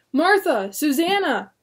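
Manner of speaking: high loud calls; calling out. Acted. William calling to his wife and daughter, loud call. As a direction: shout their names over the crowd.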